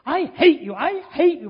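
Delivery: put-on loud, high, hateful scream of a little boy; child's angry shout. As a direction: yell it like a furious child at his mum. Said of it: "he shouted at me with hatred in his face".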